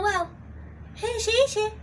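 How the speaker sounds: normal talking voice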